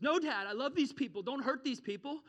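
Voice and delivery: high, loud pleading son; hammed-up plea to Dad; Jesus. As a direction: step in urgently to stop an angry father. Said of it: "Jesus steps in and says".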